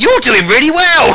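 high, exclaimed posh praise; impressed posh man. Retold or imitated. Posh man praising the host, high gushing posh voice. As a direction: imitate a posh man gushing congratulations, pitched well up. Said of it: "he is actually posh".

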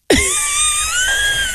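laughing out loud in a high shriek; real laugh bursting out high; loud high burst of laughter. Real, not acted. Host cracking up at her own story, loud high laugh. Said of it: "Hilarious child".

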